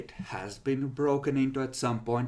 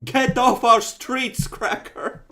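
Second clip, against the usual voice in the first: slow, loud, higher hostile shout; angry yell; 11 semitones higher, 9 dB louder, slower than usual. Acted. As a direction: drive him off the street. GM voices hostile local.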